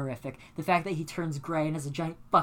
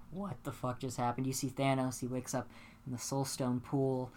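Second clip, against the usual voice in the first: deadpan storytelling; 5 semitones lower, 8 dB quieter than usual. Real, not acted. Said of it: "I have, like, a Mike Birbiglia delivery right now".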